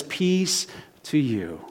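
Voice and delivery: slow, softened blessing; calm reassurance. Retold or imitated. God arriving amid all that chaos, slow and calming. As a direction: ease right down and soothe.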